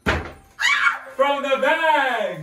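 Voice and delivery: slow, loud, high reveal; dramatic reveal. Acted. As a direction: stretch it out like a showman. Big reveal of the bag.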